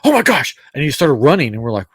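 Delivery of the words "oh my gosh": higher, louder sudden gasp; excited exclamation. Retold or imitated. Laid-back brother suddenly spotting his character, excited. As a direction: burst out in surprise. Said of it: "Chris just goes".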